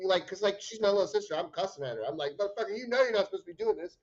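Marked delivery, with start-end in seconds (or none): 2.4-4.0 fast, higher mock scold at a toddler; mock scolding yell. Big brother yelling at toddler sister, mock scold. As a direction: rattle it off like a fed-up big brother.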